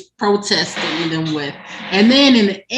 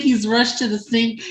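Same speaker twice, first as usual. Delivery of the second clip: usual speaking voice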